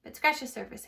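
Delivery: ordinary delivery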